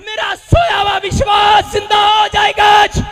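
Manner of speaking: loud, high revival preacher shout; rousing faith proclamation, building to a long held finish; full-force preaching. Real, not acted. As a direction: drive each declaration home to the crowd.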